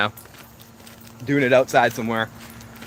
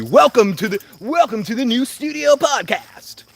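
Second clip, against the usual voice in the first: announcer voice; 8 semitones higher than usual. Pretend. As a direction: announce it like a show host. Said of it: "announces future podcast intro".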